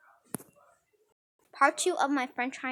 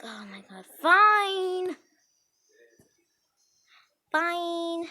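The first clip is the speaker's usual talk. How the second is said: hushed sigh, then a high drawn-out "fine"; exasperated giving in; 5 semitones higher, 5 dB louder, much slower than usual. Retold or imitated. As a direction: give in grudgingly, loudly. Friend gives in to plea.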